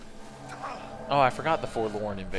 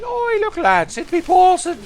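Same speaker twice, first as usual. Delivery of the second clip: high, mock Irish accent; hammed-up Irish lilt calling the lads over; 6 dB louder than usual. Teasing a voice actor about his name, mock Irish accent. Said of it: "that's how I always want to say your name".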